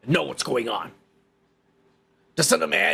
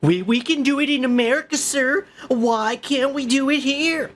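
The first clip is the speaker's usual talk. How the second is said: high, loud whiny mock of the tourist; mimicking the tourist's plea; mocking imitation; 9 semitones higher, 4 dB louder than usual. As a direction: parody him, high and loud. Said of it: "What the fuck are you doing in our country".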